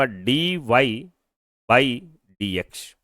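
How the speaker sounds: "dy", pause, "by", pause, "dx", each part set apart; read out slowly. Shown as a demonstration. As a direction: separate the three parts of "dy by dx" with pauses. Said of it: "तो मैं इसे ऐसे पढ़ूंगा".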